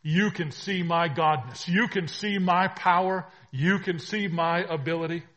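loud, high, punched proclamation; preaching at full force. Acted. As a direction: proclaim each line like a trumpet. Preaching Jesus' words.

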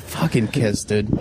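ordinary delivery

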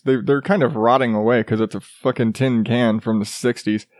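ordinary talk, nothing marked

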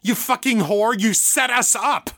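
high furious yell; raging accusation. A narrator's reading. Victor bursting back in, accusing Mother in furious rage. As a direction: hurl it at her in fresh rage. Fresh rage burning in his eyes.

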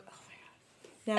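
whispered oh my God in awe; awed whisper. Real, not acted. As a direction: breathe it out in amazement. Awed recollection.

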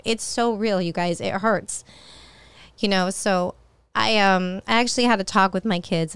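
usual speaking voice

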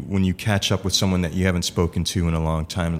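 ordinary talk

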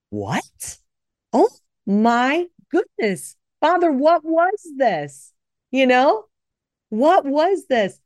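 slow, high, stunned wonder at what she'd read; amazed excitement. Retold or imitated. Retelling her late-night reading to listeners, slow amazed awe. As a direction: gasp it out in awe, drawn out. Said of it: "I was just so excited".

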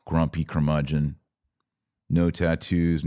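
ordinary delivery

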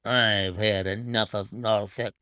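lightly voiced half-drunk servant, low and slurred; a hint of a tipsy, insolent man grumbling; thick half-drunken voice. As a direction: drunk Hudson announcing he'll leave. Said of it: "in the thick voice of a half-drunken man".

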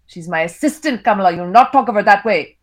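put-on stern husband with an Indian accent; Indian father's voice. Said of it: "I read in my characters' voices".

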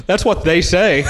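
laughing through the line, then a high laugh. Real, not acted. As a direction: crack up at the joke. Host laughing at the joke.

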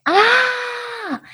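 sharp, high sudden "ah!"; startled cry. Real, not acted. Teacher cries out, suddenly startled. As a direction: jolt out a surprised yelp.

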